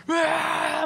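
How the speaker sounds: high, drawn-out acted yell; frenzied high-pitched holler; excited scream. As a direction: scream like an overexcited crowd. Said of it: "애들이 막 흥분하고 막".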